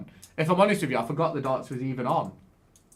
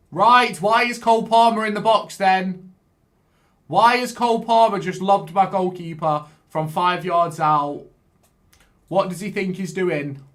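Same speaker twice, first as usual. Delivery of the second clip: loud exasperated rant at the screen; angry at the game; 5 semitones higher, 9 dB louder than usual. Real, not acted. Gamer yelling at his keeper's blunder, angry shout. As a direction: shout in disbelief at your own goalkeeper.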